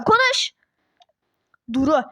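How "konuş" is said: shouted order. Acted. Duru shouting at the boy to make him speak. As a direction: yell the word to break his silence. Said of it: "bağırma çocuğa".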